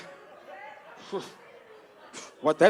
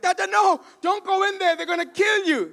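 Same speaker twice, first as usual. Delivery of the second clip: frantic warning; 10 semitones higher, 5 dB louder than usual. Retold or imitated. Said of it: "You can't be like".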